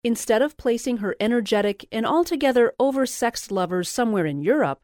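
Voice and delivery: plain reading voice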